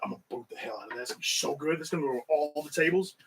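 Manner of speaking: quiet gleeful inner voice. Retold or imitated. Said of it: "I was like".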